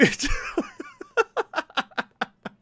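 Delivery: a high, loud burst of laughter. Real, not acted. Gamer laughing at the endless side quests, a high burst. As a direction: crack up at yourself.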